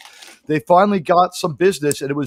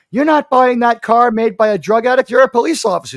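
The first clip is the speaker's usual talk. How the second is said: stern mother; 6 semitones higher, 5 dB louder than usual. Retold or imitated. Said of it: "my mother's like".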